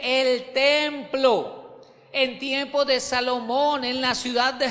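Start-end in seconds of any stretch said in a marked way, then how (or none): 0.0-1.6 slow, stretched and ringing; preacher's long, weighty stretch; drawn-out emphasis. Real, not acted. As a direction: draw each syllable out for the congregation. Preacher stretches the key words.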